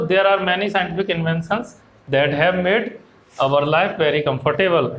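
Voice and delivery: very slow, even English reading for students; a teacher reading a textbook line slowly and clearly; slow flowing read-aloud. Shown as a demonstration. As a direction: read it out slowly so the class can follow. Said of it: "हम रुक के थोड़ा सा यहाँ रुक जाएंगे".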